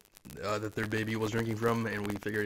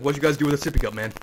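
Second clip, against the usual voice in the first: louder, higher, pushy mock confrontation; hyped-up demand; 4 semitones higher, 7 dB louder, faster than usual. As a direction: get in his face, fired up. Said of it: "much more hyped up".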